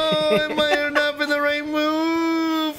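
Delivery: high, slow mock lament; mock regret. Real, not acted. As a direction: sigh it out theatrically.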